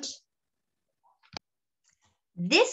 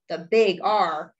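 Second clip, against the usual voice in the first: ordinary delivery, nothing marked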